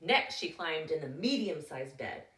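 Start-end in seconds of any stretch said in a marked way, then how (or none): none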